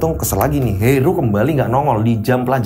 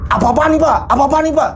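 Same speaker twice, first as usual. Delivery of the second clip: shocked protest; 10 semitones higher than usual. Acted. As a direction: cry out as the scissors cut. Student protests as hair is cut.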